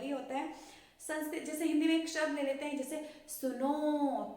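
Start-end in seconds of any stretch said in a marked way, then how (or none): 3.3-4.3 सुनो with the final ओ held long and slow; prolonged vowel. Shown as a demonstration. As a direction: stretch out सुनो as a pluta demo. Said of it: "सुनो का उच्चारण अगर हमें थोड़ा सा ज़्यादा". (सुनो का ओ धीरे और लंबा खींचकर)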